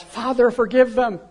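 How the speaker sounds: loud, high anguished prayer; loud plea. Retold or imitated. Jesus praying aloud as he is laid on the cross, loud and high.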